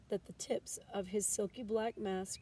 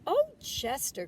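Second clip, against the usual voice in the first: louder, higher, drawn-out Oh; crying out; 7 semitones higher, 9 dB louder, slower than usual. A narrator's reading. Mother reassures her upset son, louder cry. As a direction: call out to him in loving dismay. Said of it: "cried Missus Raccoon".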